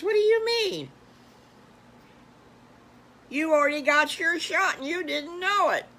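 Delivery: high, loud astonished piglet voice; surprised piglet. Acted. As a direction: squeak it out in disbelief. Piglet shocked by brother's news.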